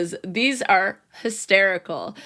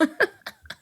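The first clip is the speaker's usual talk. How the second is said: laughing; 7 semitones higher, 4 dB quieter than usual. Real, not acted. Laugh after mock scream.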